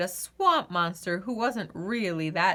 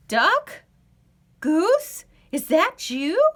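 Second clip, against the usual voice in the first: high, louder calling voice; monster calling out; 7 semitones higher, 6 dB louder, slower than usual. Acted. Swamp monster calls for Duck and Goose, high. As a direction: call out searching for friends. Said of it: "the swamp monster called".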